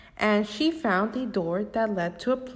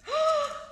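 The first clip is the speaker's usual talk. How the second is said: dramatic gasp. Acted. Mock gasp at sequel news.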